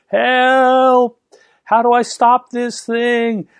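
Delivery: high, loud, drawn-out mock scream for help; retold panicked scream. Rider on runaway pony calls to anyone, mock panicked scream. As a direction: re-enact a runaway rider's yell, stretched out and high. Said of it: "screaming all the way".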